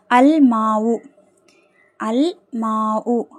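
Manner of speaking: "அல் மாவு" with a held L and long aa; teacher's measured "அல் மாவு", clear L; lam pronounced. Shown as a demonstration. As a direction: draw out "அல் மாவு", sounding the L. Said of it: "லாம் வந்து உச்சரிக்கப்படணும்".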